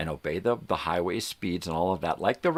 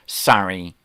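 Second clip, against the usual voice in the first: curt mock sorry; 8 dB louder than usual. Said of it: "said out the side of her fucking face".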